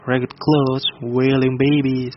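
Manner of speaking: ordinary delivery, nothing marked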